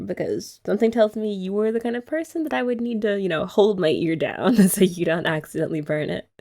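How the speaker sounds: ordinary talk, nothing marked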